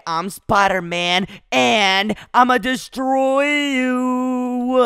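slow, drawn-out superhero declaration; heroic threat. Acted. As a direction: proclaim it grandly, stretching the last word. Acts out hero threatening Venom.

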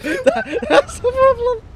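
laughing cry. Real, not acted. Panic breaks into laugh-crying.

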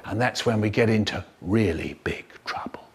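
hushed ominous whisper. A narrator's reading. Narrator whispers the coming trouble, ominous and hushed. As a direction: breathe it like a secret.

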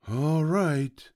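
high piglet voice with a falling sigh. A narrator's reading. Piglet giving up the struggle, sighing high. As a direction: give in on a high, falling note. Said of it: "sighed the piglet".

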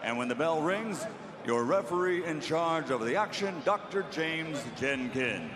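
booming boxing ring announcer. Acted. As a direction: announce it to the arena.

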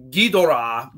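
Ghidorah with all three syllables, slowly; careful, unswallowed Ghidorah; full pronunciation. Shown as a demonstration. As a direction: sound out the middle "do" in Ghidorah. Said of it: "syllables and consonants sometimes get swallowed up".

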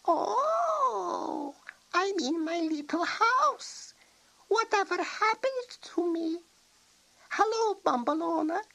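put-on high, bewildered old witch voice; old witch returned from being a toad; puzzled old witch. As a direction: pitch up, waking confused.

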